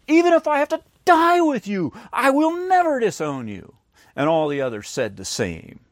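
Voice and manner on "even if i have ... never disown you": higher, louder boast of never failing; mock-emphatic Peter vow; emphatic insistence. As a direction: protest it like Peter swearing loyalty. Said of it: "he insisted emphatically".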